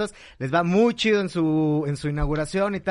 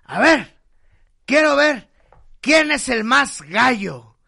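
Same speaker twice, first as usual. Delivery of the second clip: put-on loud, high, slow drunk bragging; drunk challenge; 9 semitones higher, 8 dB louder, slower than usual. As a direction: bellow the challenge at the whole bar. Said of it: "empieza así de".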